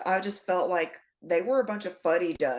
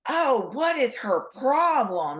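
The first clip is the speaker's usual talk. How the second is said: indignant teacher; 6 semitones higher, 3 dB louder, slower than usual. Retold or imitated. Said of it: "she was like".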